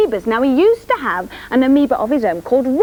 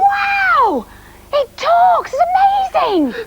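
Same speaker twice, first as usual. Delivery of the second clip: much higher, bright amazed cries; amazed squeal; 3 dB louder, much slower than usual. Acted. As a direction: gasp it out like you've seen a miracle. Amazed that plant talks.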